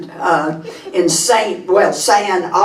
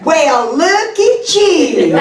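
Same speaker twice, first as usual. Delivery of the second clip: high, drawn-out Texas greeting; gushing Texas women; 7 semitones higher, 4 dB louder, slower than usual. Retold or imitated. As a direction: squeal it slowly with a big Texas drawl. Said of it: "when two Texas women meet".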